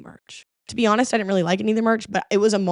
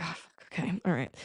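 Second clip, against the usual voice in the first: reluctant mutter; 3 semitones lower, 13 dB quieter than usual. Retold or imitated. Reluctantly giving in.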